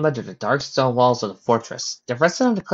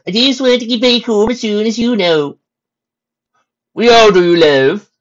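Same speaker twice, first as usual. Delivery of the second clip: stallion soldiers chatting; 11 semitones higher, 10 dB louder than usual. Acted. Soldier stallions talking over peace terms, loud and high. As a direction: push the voice up and out.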